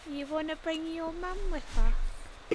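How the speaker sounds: high-pitched imitation of a vampire's accent; Drusilla. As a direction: mimic the vampire woman, pitched well up. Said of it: "Love Drusilla's accent".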